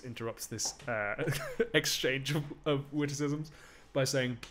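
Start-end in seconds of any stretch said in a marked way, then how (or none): none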